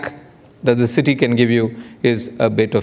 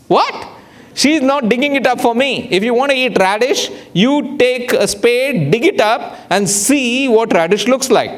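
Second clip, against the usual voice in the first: high-pitched, emphatic indignation; mock outraged disbelief; 8 semitones higher than usual. Diner told to dig his own radish, indignant. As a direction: pitch it way up in comic outrage, stretching key words.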